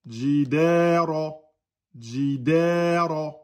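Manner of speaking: Jidara with a long held "da"; two-beat long vowel. Shown as a demonstration. Teacher modelling vowel length, Jidara drawn out. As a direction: slowly hold the middle vowel of Jidara for two beats. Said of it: "Da, deux temps".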